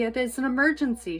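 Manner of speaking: loud, high, fast alarm; shouted. A narrator's reading. Mister Watson shouting about the emergency, loud and fast. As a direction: sound the alarm. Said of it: "shouted Mister Watson".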